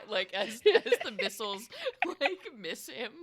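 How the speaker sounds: ordinary talk, nothing marked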